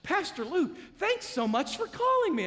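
high-pitched mock gushing; hammed-up awe; starstruck fan. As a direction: imitate someone thrilled by the caller. Imitates awed caller thanking the pastor.

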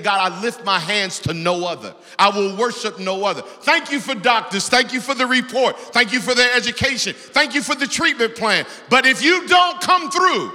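loud, high, building cry to God; pleading prayer. Retold or imitated. Preacher voicing a believer's prayer, loud and rising. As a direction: climb to a desperate peak.

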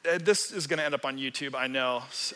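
normal talking voice

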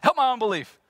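father exclaims. Acted. Father crying to Jesus for his son, desperate shout. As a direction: cry it out at the top of your voice. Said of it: "the boy's father then exclaims".

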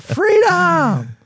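high, loud Scottish battle cry; Braveheart Freedom yell. Pretend. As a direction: stretch it out like Mel Gibson in a kilt. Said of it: "Little Mel Gibson Braveheart".

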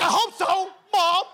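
high, loud cheeky reply; sarcastic. Retold or imitated. Son answering his mom about penguins, high and cheeky. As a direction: tease Mom with mock hope. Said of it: "I'm like".

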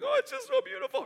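high, choked-up squeak of emotion; imitating himself overcome and tearing up; teary overwhelmed voice. Husband tells wife on the couch why he's teary, high choked-up voice. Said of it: "kinda, like, tearing up".

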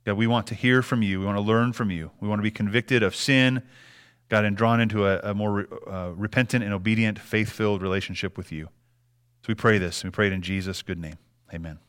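soft, low, earnest prayer voice. Acted. Pastor praying over the congregation, soft and earnest. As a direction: pray it quietly before God.

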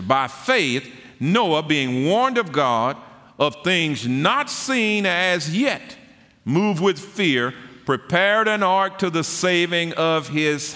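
high, loud, stretched scripture proclamation; full-force preaching. Real, not acted. Preacher proclaiming Noah's faith to the congregation, full force. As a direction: build the verse up into a ringing high cry.

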